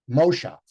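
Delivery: moshav stressed on MO; stress on first syllable. Shown as a demonstration. Teacher shifting stress: MOshav. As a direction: put the accent earlier, say MOshav. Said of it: "move the accent one step earlier".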